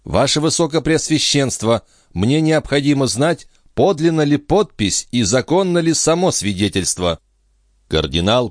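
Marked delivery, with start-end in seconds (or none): 0.1-7.2 high, urgent princess voice; insistent princess. A narrator's reading. The princess asks the cardinal to verify the certificate, insistent. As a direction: press the request in a raised pitch.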